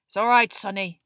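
gruff, loud-ish reassurance; gentler growl. A narrator's reading. Moody comforts Neville, in a gentler growl. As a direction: growl comfort to a shaken boy. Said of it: "much lower and gentler growl".

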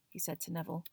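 ordinary delivery

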